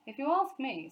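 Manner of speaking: a bit of a knowing father; Mr Brown's voice. A narrator's reading.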